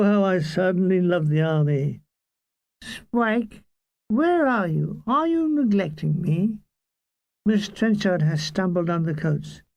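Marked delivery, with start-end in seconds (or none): 2.8-6.6 lightly voiced plaintive woman, pitched up at first; neglected woman calling. A narrator's reading. Left-behind passenger calls to her companion, lightly voiced plaintive woman. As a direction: call out for him with mild reproach.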